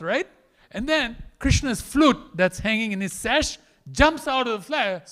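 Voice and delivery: ordinary talk, nothing marked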